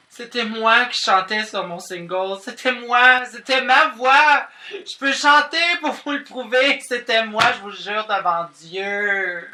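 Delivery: high, loud, hammed-up pleading; desperate protest. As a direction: plead frantically, stretching the final words. Frantic protest that she really sang. (supplication désespérée et théâtrale)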